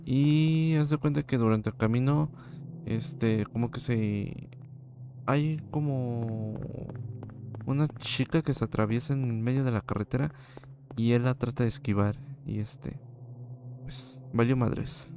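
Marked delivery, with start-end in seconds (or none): none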